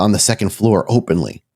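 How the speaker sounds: ordinary delivery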